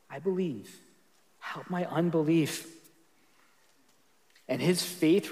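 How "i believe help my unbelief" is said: much softer, gentle plea; tender heart cry. Shown as a demonstration. Pastor rereading the father's plea to Jesus, soft and tender. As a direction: breathe it out tenderly like a broken father. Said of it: "hear the father's heart cry with a little more tenderness".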